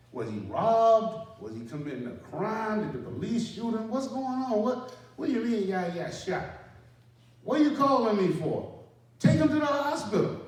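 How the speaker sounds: high, loud, exasperated demands; exasperated yelling. Retold or imitated. Sergeant yelling at his team leader to take him to hospital. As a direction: shout at him to just handle it.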